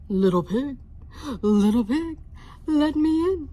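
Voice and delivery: loud, growly-free big bad wolf call; wolf at the door, loud. Wolf voiced in read story.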